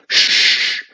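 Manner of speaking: sharp, loud "shh"; loud shush. Acted. Wizard shushing his cousin while counting, loud shush. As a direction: hush someone who interrupts. Wizard hushing the interrupting witch.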